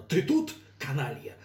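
loud threatening bellow; menacing shout. Acted. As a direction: roar it menacingly. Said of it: "Член правления грозно".

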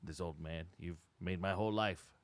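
quiet and sad. Acted. Sad old salesman confiding.

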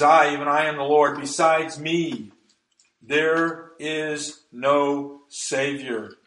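"I, even I am the Lord" loud, then "there is no Savior" very slow; emphatic. Acted. Preacher showing congregation God's emphatic language, emphatic. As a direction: hammer "I", then space out "there is no Savior" word by word. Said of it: "That is what the Bible calls emphatic language".

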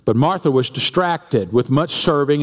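normal talking voice

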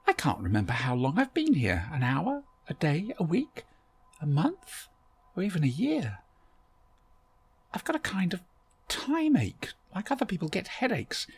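wondering aloud how long she's been here; lightly voiced Barbara. A narrator's reading.

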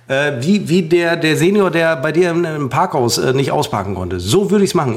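ordinary delivery

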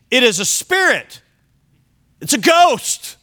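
rising panic, voice shooting up on "ghost"; frightened disciples. Retold or imitated. Disciples on the sea crying out in fear of a ghost. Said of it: "they were troubled, saying".

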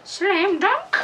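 high squeaky echo. Pretend. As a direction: pitch it way up. Echoing in high voice.

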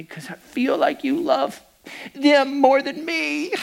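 lightly voiced hurt seven-year-old; voicing his tearful daughter; a hint of a little daughter. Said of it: "And she says to me".

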